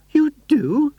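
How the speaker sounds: high, delighted gasp of a question; surprised cry. A narrator's reading. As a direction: leap at the news. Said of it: "she cried".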